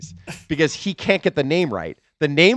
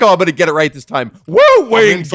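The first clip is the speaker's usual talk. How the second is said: imitating Ric Flair; 3 semitones higher, 8 dB louder than usual. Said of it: "He was like".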